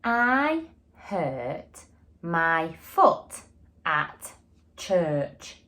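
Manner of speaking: each word slow and deliberate; slow modelled sentence. Shown as a demonstration. Teacher modelling a sentence to young pupils, slow and clear. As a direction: say it slowly for the class to copy. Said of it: "my sentence is going to be".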